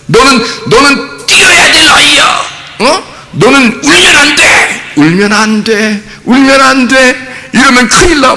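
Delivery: a father lecturing a crying baby, hammed-up; mock scolding father. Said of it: "아빠가 오자마자도 시끄러워".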